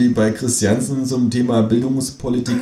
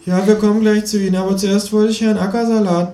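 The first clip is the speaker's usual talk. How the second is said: much higher piping voice; squeaky host; 9 semitones higher than usual. Acted. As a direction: pipe it like a nervous host. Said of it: "piept die Moderatorin".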